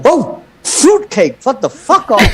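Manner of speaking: high, slow, exaggerated sneer; mock high retort. Man quoting himself brushing off the swimmer, high mock. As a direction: toss it off in a high mocking voice. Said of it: "I'm like".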